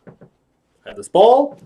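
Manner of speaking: slow, high, rising showman lilt; magician's patter. Acted. Clown shows De Niro a trick, high rising patter. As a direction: lift the last word high.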